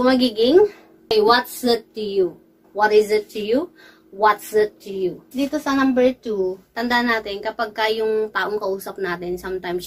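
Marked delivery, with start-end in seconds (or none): 1.2-5.2 slow, careful contrast of what's and what is; teacher contrasting contraction; contracted what's vs full what is. Shown as a demonstration. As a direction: say "What's" as one clipped syllable, then "What is" in full, then "What's" again. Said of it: "itong buong-buong phrase na ito".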